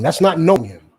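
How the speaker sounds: ordinary delivery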